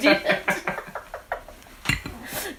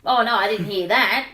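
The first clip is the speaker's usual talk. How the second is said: normal talking voice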